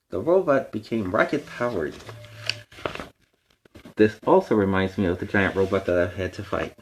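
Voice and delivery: usual speaking voice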